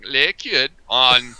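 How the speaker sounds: slow, drawn-out Viking voice; Viking accent. Shown as a demonstration. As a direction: stretch it like a Viking. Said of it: "I'm gonna say it like I'm a Viking".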